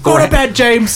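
high, loud mock order; mock scolding. Acts out wife's reply to whisper.

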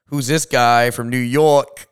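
slow, loud, drawn-out New York challenge; mock a local sizing up the newcomer; New York tough guy. Locals asking who the New York kid is, loud slow New York voice. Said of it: "And people are like".

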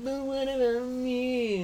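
slow whining plea; whiny voice. Retold or imitated. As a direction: whine it out slowly. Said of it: "it feels like".

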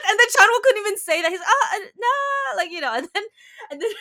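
high, loud, fast laughing retelling; can barely get it out through laughter; laughing hard. Real, not acted. Retelling the awkward scene to a friend, laughing hard.

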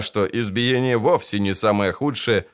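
plain reading voice

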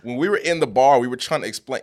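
usual speaking voice

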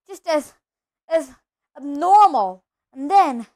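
loud, emphatic scorn; bitter rant. A narrator's reading. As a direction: spit out a long-held grudge loudly. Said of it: "then went ranting on".

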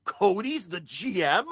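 hammed-up fan losing it over Cody; mock thrilled fan. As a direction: act like a fan whose mind is blown. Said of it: "there's people who are like".

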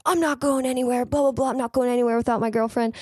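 high, heated refusal to leave; a drunk man shouting he won't go; angry protest. Retold or imitated. Drunk bloody man refusing the ambulance, high and heated. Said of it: "starts to get super fucking mad".

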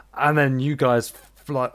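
ordinary delivery

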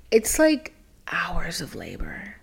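slow unvoiced whisper; whispered aside. Real, not acted. To the listener about admitting trauma, slow whisper.

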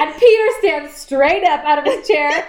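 high, laughing through the story; laughing retelling. Real, not acted. Fan laughing while retelling the dinner scene. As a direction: giggle while telling it. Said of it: "retelling a funny scene".

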